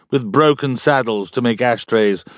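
ordinary delivery, nothing marked

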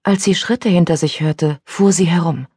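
plain reading voice

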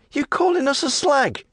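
put-on high, affronted young woman; indignant lass.

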